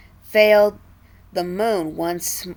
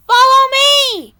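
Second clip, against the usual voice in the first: high ringing shout, much louder; 10 dB louder than usual. A narrator's reading. Jake shouting to his brothers to follow. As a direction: call the brothers to follow, loud. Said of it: "Jake shouted to his brothers".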